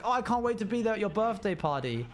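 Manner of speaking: high, in-character enthusiasm; acted excitement. Playing along about Harry's birthday party, acted excitement. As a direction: gush as if it were true. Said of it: "Wait, drop character".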